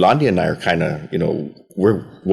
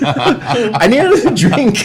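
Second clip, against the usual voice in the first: much higher, slow laughing line; 7 dB louder, slower than usual. Real, not acted. Cornered at happy hour, laughing aside. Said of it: "laughing at himself".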